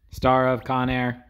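mock computer readout; robot voice. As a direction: finish the fact like a search bot. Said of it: "the original Google bot".

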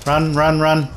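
urgent shout. Real, not acted. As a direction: call it out loud and urgent. Urgent co-op firefight, fleeing.

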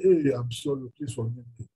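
meek apology to the man at the bus stop; gentle apology. Retold or imitated.

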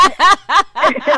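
loud, high laugh at the joke; real helpless laugh. Real, not acted. Radio host laughing after being pranked live. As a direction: let the laugh break out.

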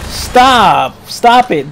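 stop. Real, not acted. Yelling at game enemy.

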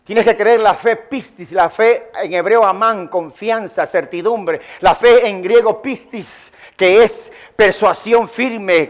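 ordinary delivery

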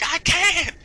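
high, loud wail; hammed-up desperate yell; mock anguished cry. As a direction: cry it like a helpless movie victim. Acted victim's reply.

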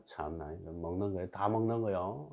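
ordinary talk

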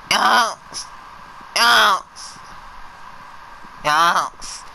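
high excited yelps of yes; yasing. Real, not acted. Player gushing at the pretty waterfall, high yelps. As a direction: chant yes over and over. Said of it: "Nobody likes your yasing".

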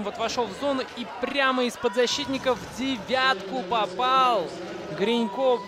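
excited replay shout. Real, not acted. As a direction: call the replay goal with a shout.

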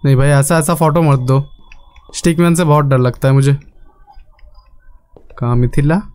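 usual speaking voice

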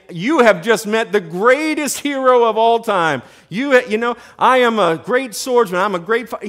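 loud, high mock boasting; hammed-up self-proclaimed hero; tooting own horn. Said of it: "kind of tooting his own horn".

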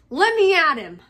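loud, high angry outburst; angry growl. A narrator's reading. Ron held back from Malfoy by his friends, growling angrily. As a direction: lunge at him with your voice, furious. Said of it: "Ron growled".

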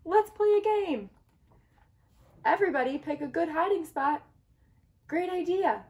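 rally the gang for a game; high thrilled egg voices calling out; excited eggs. Acted. Eggs plan hide and seek.

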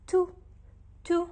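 تو with a rounded "u" vowel; crisp demonstration of تو, lips rounded on "u"; tu with damma. Shown as a demonstration. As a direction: pronounce تو as a short, isolated "tu". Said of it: "ضمة حتلفظ".

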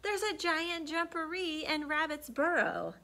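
scared cat. Acted.

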